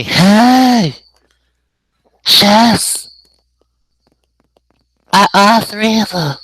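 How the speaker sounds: loud, high put-on character voice; V Siri. Guest answering host's hello, high shouted character voice. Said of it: "Let's say hello to V Siri".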